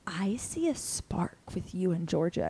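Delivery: soft conspiratorial whisper. Retold or imitated. Telling the guy a secret out of Georgia's earshot, whispered. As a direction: lean in and breathe it secretly.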